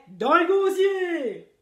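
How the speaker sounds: loud, very slow crew shout; whole crew shouting a toast. A narrator's reading. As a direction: holler it back as one. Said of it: "tout le monde criait".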